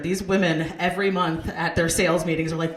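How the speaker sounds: ordinary talk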